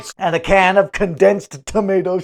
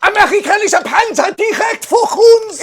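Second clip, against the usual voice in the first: put-on barked German army accent, higher and louder; German soldier voice; 14 semitones higher, 6 dB louder than usual. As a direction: play a German tank commander calling out the enemy tank in a German accent. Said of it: "It's French!".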